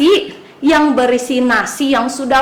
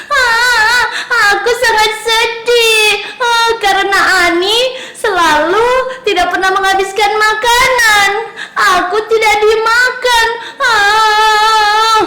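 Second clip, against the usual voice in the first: high, loud sing-song sobbing; hammed-up storybook crying, high and loud; crying rice; 8 semitones higher, 3 dB louder than usual. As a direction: wail like a sad little rice grain. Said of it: "katanya nasi menangis".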